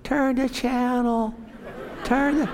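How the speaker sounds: high, slow, gentle nudging voice; inner voice of God. Acted. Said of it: "you hear something going".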